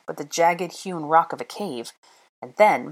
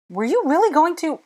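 high-pitched aghast question; high alarm; 12 semitones higher, 4 dB louder than usual. A narrator's reading. Hermione to Draco after his threat, high alarm. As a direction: ask it aghast, voice leaping up.

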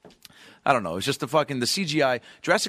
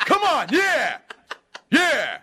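sudden high loud cheer from the back; lone cheer; 6 dB louder, much slower than usual. Retold or imitated. Cheering for a name nobody else cheered, loud yell.

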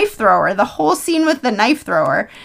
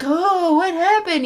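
higher, softer puzzled voice; lightly mocked high bewilderment; dazed knife thrower; 7 semitones higher, 4 dB quieter, slower than usual. Voicing the knife thrower losing his knife, dazed mock puzzlement.

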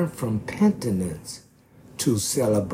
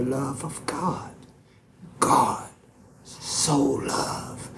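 1 dramatic whisper; 2 semitones higher, slower than usual. Acted. Whispered preaching for effect.

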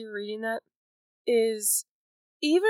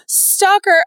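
calling out stalker higher and louder, mock alarm; 11 semitones higher, 7 dB louder than usual. To her cohost about a creepy guy, mock alarmed call. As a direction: warn of a creepy guy, half-joking and loud. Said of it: "you're like".

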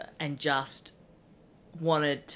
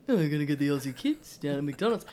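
lightly voiced knockabout talkback politician; a hint of a blokey radio guest; Peter Dutton; 2 semitones lower than usual. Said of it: "bit of Peter Dutton".

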